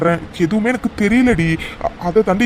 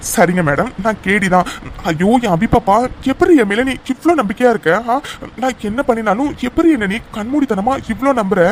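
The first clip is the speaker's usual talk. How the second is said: ordinary talk, nothing marked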